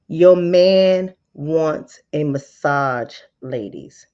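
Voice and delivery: slow, pointed pitch; slow emphatic pitch. Shown as a demonstration. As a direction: drag it out to sell it. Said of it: "slower repeat".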